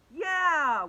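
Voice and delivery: high, loud, drawn-out cheer of support; shouted agreement. A narrator's reading. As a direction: chime in loudly like a kid. All the kids back Emily up.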